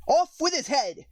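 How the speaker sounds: high, shrill-pitched royal yell; shouting. A narrator's reading. The Queen shouting at croquet players, a high furious yell. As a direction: bellow the sentence like a raging Queen. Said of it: "quarreling with the other players and shouting".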